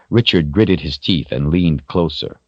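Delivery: plain reading voice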